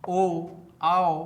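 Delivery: O and AO in ओ, आओ stretched very slowly; teacher lengthening ओ and आओ vowel by vowel; drawn-out vowel demo. Shown as a demonstration. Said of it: "शब्दों में vowel ओ का उच्चारण".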